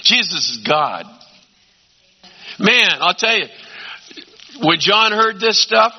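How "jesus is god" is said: usual speaking voice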